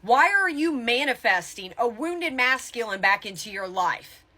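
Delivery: ordinary talk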